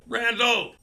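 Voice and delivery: shouting. A narrator's reading. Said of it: "Greg's voice shouted".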